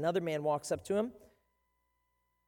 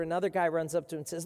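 usual speaking voice

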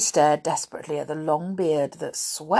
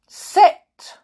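loud, high sharp order; barked command; 15 dB louder than usual. A narrator's reading. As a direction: command them like little dogs. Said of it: "as if the brownies were all little dogs".

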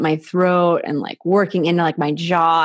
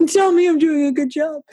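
playful plea; 10 semitones higher than usual. Pretend. Playfully voicing her own request.

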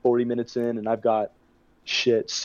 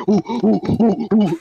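higher, louder monkey hoots breaking into a laugh; monkey noises; 8 semitones higher, 5 dB louder, slower than usual. Retold or imitated. Party guy banging a trash can, monkey hoots. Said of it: "making monkey noises".

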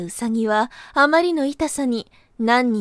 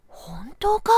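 whispering; 5 dB quieter, much slower than usual. Acted. Taro doubtful, hushed.

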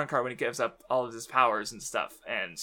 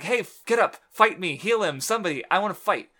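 imitating a fighter, louder and higher; fired-up challenge; 5 semitones higher, 6 dB louder than usual. Podcaster mimics Yusuke itching for another fight, fired-up challenge. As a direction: act out a raised-voice demand for a fight.

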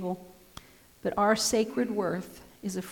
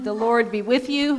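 louder chanted call to worship; liturgical call; 2 semitones higher, 6 dB louder than usual. Acted. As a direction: proclaim it to the congregation. Pastor leads liturgy.

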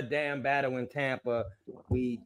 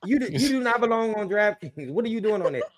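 high, loud laughing scold; playful laughing ribbing; laughing tease; 9 semitones higher, 4 dB louder than usual. Real, not acted. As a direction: scold him through laughter. Teasing a colleague.

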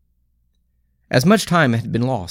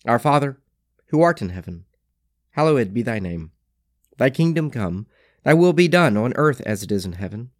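prayer. Acted.

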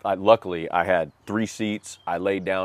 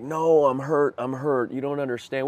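retold pleading; 7 semitones higher, 4 dB louder than usual. Arguing with wife, crying.

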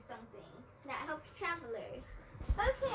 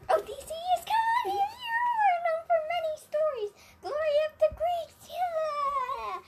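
high, luring siren call; sing-song temptress calling a sailor; 12 semitones higher, 5 dB louder than usual. Acted.

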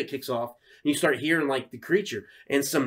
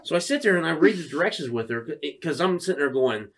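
usual speaking voice

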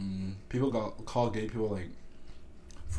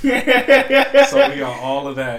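loud, higher, through real laughter; laughing realization; 4 semitones higher, 12 dB louder than usual. Real, not acted. Guest learning host was already recording, laughing. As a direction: crack up as you say it.

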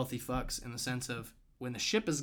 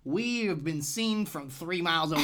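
high, loud goofy marching soldier; mocking redcoats; 7 semitones higher, 4 dB louder than usual. Mocking redcoats marching in a line, high and silly. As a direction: pitch way up and ham it up as a foolish soldier. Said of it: "little drummer boy in front going".

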